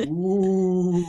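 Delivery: drawn-out ghostly ooh; a playful haunted-house moan; playful spooky voice. Acted. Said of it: "That's a great spooky voice".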